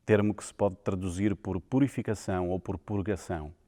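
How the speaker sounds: normal talking voice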